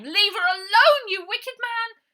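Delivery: high, very loud tearful scream; furious scream. A narrator's reading. Ida attacking Uncle Arthur, high tearful scream. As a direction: scream and fight him off.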